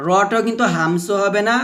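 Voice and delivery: ordinary delivery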